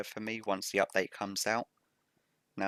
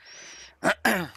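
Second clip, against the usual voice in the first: quick rough throat clear; 11 semitones higher, 6 dB louder than usual. Real, not acted. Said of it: "Sorry about that".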